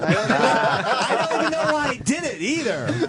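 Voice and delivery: high, laughing confession. Real, not acted. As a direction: giggle through the words. Laughing after admitting the lie.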